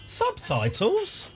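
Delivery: drawn-out, high incredulous "Subtitles?"; a slow, high, rising "Subtitles?" in mock shock; mock disbelief. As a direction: stretch "Subtitles?" slowly up high as if amazed. Echoes own word in disbelief.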